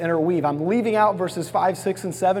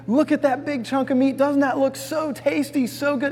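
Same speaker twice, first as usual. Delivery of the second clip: higher, coaxing sweet-talk; enticing lure; 7 semitones higher than usual. Retold or imitated. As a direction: tempt the tiger like bait. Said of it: "this enticing".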